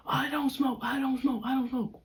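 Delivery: insist, getting worked up; defensive denial. Retold or imitated. Boyfriend snapping his denial at her.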